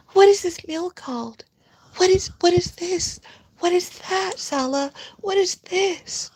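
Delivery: mocking her playing fancy about a burger meal; mock-refined voice. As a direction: act dainty and clueless. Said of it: "Trying to appear, like, less, like, refined".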